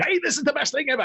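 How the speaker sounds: high, chirpy salesman pitch; mocking Rip's sales patter; used car salesman. Imitating the used car salesman to a friend, mock salesman voice. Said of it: "a used car salesman all fucking day long".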